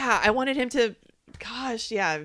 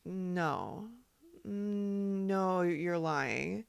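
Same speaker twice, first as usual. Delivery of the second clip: softly stunned, lightly acted denial; quiet mock disbelief; 6 dB quieter, much slower than usual. Host voices Mora waiting for "I'm kidding", quiet mock disbelief. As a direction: imitate someone refusing to believe it, hushed. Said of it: "she's like".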